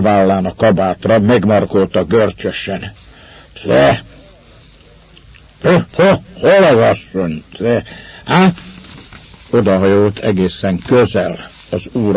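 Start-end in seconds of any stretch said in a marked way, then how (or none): 3.6-8.5 put-on raised, broken drunk calls; drunken demand. A narrator's reading. Drunk Ivan clutching the master's coat, drunken demand. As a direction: badger him in drunken bursts.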